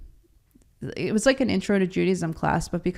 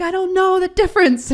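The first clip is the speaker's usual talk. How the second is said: much higher and louder, exasperated; protesting; 13 semitones higher, 8 dB louder than usual. Retold or imitated. Wife defending herself to her husband, exasperated shout. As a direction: throw it back at him, defensive.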